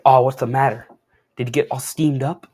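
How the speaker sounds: loud mocking taunt; gloating pun. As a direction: rub it in with a grin. Hulk taunting with puns.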